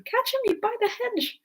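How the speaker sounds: high-pitched urgent call; high rabbit shouting commands; rabbit's voice. A narrator's reading. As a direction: call out an order in a high put-on voice. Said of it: "the rabbit's voice alone".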